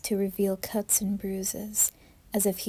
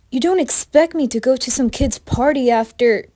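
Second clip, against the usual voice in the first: higher, loud, disbelieving; indignant protest; 5 semitones higher, 9 dB louder than usual. Acted. Jeff protesting to his mom about the party, loud indignant. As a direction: push back hard at mom.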